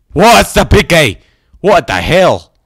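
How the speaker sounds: high, very loud alarm; startled shout. Real, not acted. Player startled by a creature in a weird place, startled shout. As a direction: yell in shocked disbelief.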